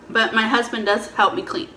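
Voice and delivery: ordinary delivery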